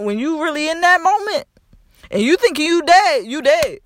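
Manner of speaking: high, loud, urgent retelling; frantic retelling of feeling dead; reliving feeling dead. Retold or imitated. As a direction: relive the terror, voice climbing high. Reliving terrifying trip, raised voice.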